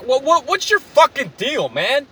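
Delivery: high, drawn-out exasperated outburst; clerk snapping. Retold or imitated. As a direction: imitate a worker losing it at a customer. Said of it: "And then she fucking snaps".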